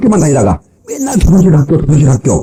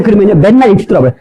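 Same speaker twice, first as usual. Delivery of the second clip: ordinary talk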